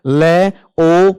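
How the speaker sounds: "ले, ओ" each syllable said slowly and apart; sounding out syllables. Shown as a demonstration. Teacher sounding out the opening sounds for students. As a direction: break the word into its first sounds. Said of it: "देखो शुरुआत में क्या है?".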